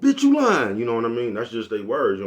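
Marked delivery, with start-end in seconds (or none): 0.0-0.8 loud, high-pitched mimicry of Southern slang; exaggerated high holler, mock Southerner; mocking Southern talk. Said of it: "they been talking like this forever".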